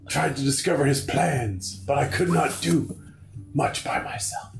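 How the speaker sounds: lightly hammed-up high voice; dramatic game character. Gamer reading the dying character's lines, mildly melodramatic. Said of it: "I guess we gotta do voices".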